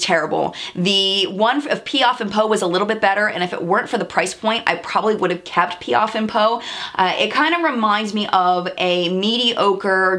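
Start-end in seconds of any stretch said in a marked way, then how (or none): none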